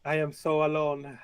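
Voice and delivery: hammed-up self-pity; Señor Fluffington lamenting. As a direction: moan it with theatrical loneliness. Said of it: "there's a big sigh from Señor Fluffington".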